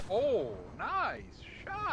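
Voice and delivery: loud, high cheer, drawn out; excited praise. Real, not acted. Player cheering a teammate's shot, excited shout. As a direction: call it out big, pitch leaping up.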